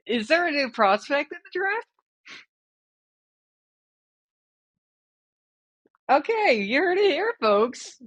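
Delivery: high, mocking announcer voice; sarcastic. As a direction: sneer at the bad pick. Mocks the pick sarcastically.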